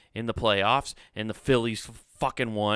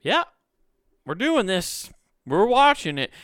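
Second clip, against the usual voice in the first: higher, louder forced pep; mock enthusiasm; 8 semitones higher, 4 dB louder than usual. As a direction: pretend to be pumped, hammed up. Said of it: "You're just kinda".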